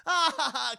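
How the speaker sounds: high, loud, put-on laugh; mocking laugh. Friends laughing off the hero's plea, high mock laugh. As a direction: burst into a fake jolly ha-ha.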